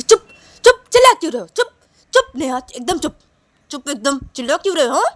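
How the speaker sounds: sharp repeated bursts of command; angry silencing. Acted. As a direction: snap at them to stop yelling. Sneha slaps Neha, demands silence. (डपटकर चुप कराना)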